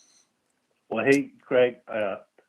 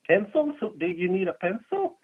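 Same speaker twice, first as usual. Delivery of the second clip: mock teacher; 9 semitones higher, 5 dB louder than usual. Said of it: "and the teacher's like".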